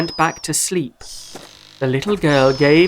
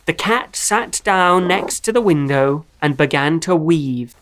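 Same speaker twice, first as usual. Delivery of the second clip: ordinary delivery, nothing marked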